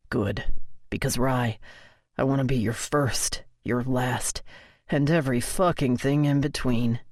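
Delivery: low male voice, every word pressed; emphasizing each word. A narrator's reading. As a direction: lean on each word as if it hurts. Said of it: "He emphasizes each word, as if it almost pains him".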